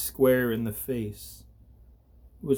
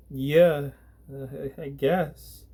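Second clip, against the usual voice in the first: shaky, stuttering; 3 semitones higher than usual. Acted. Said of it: "a shaky, stuttering voice".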